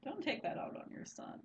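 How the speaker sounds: whispered scolding. Retold or imitated. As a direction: whisper it sharply. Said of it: "And I'm like".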